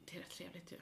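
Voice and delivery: whispered aside; whispering. Real, not acted. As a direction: whisper it under your breath. Whispered aside about the pizza.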